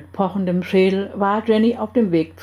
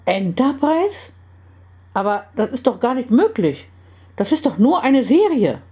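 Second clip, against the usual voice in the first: high-pitched, disbelieving outcry; incredulous exclamation; 5 semitones higher than usual. A narrator's reading. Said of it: "riefen alle drei".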